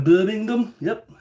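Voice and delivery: attempted Brummie lilt, rising pitch; Birmingham accent. Shown as a demonstration. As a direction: try the place name in a Birmingham accent. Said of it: "can't really do like this".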